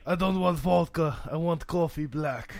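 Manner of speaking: put-on slow heavy Russian accent. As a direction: speak like a Russian. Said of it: "Russian accents".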